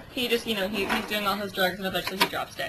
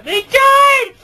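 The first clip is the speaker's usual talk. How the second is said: much higher and louder shriek; dramatic shout; 13 dB louder than usual. Acted. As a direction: yell it in shock. Dramatic outburst in the story.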